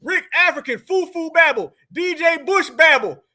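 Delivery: usual speaking voice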